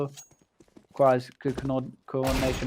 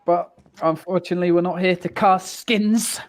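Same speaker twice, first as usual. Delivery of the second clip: esports caster; 5 semitones higher, 7 dB louder than usual. Acted. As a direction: boom it out like a caster. Caster turns back to the match.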